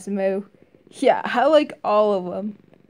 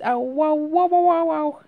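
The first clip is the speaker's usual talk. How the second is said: high, louder yelping ow wow; Luigi pain cry; 7 semitones higher, 4 dB louder than usual. Retold or imitated. Reviewer imitating Axel hit, like Luigi yelping high. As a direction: yelp like a cartoon plumber getting hit. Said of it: "he sounds like Luigi".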